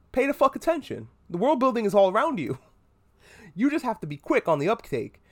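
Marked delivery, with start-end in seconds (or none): 0.1-2.6 exasperated. Acted. Mocking the worldbuilding.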